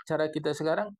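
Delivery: ordinary talk, nothing marked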